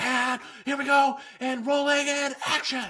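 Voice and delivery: high, far-off hammed-up director calling the shot; frantic director. A hammed-up director shouting and rolling action on set. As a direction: squeal it like an overexcited film director.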